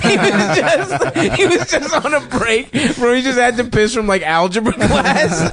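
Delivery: high-pitched, laughter-choked storytelling; laughing while talking. Real, not acted. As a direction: struggle to get it out through laughter. Cracking up at own story.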